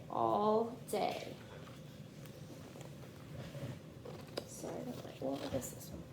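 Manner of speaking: steady storybook read-aloud for a class; gentle picture-book narration; reading to children. A narrator's reading. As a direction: read it like story time on the rug.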